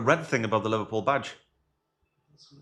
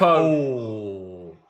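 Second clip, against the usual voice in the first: commentator's groan; 5 dB louder than usual. Real, not acted. Commentary reaction to Gakpo attempt.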